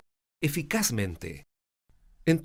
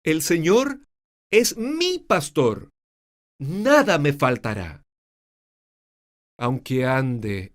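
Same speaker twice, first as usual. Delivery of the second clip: dramatic varied psalm reading; varied pitch reading; 4 dB louder, slower than usual. Shown as a demonstration. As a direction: let the melody follow each phrase. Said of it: "esa variedad es dada por las palabras".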